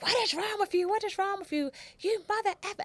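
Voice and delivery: high furious yelling; cussing out. Retold or imitated. Mother cursing out the boy's mother, enraged. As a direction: tear into her with rage. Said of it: "I cussing her out".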